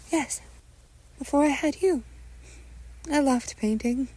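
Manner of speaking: high-pitched wistful mother; mother's voice. Acted. Mother tells son about her painting past, high motherly voice.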